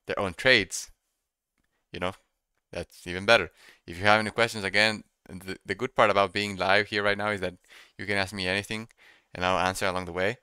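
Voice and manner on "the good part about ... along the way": ordinary talk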